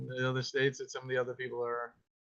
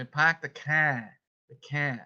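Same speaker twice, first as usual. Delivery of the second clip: slow Boston demo, R dropped; broad Boston vowels; Boston accent; slower than usual. Shown as a demonstration. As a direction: drop the R like a Bostonian. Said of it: "If you're from Boston, they say".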